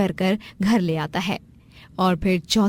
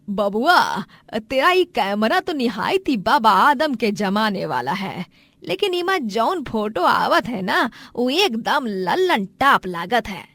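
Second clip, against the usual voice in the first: lively, dialect-heavy elder voice; swooping village-dialect delivery; rustic village mother. Acted. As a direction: tease the boy in a broad rural lilt.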